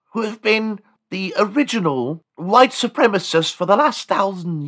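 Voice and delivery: high-pitched, pompous English speechmaker; hammed-up English aristocrat explaining himself; Sir John Dickenhand. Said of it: "Sir John Dickenhand".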